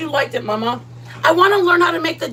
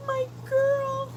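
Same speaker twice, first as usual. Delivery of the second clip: fond greeting; 13 semitones higher, 7 dB quieter than usual. Real, not acted. Greets viewer arriving.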